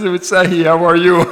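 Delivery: laughing imitation of the greeting. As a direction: repeat the greeting while laughing. Retold again, laughing.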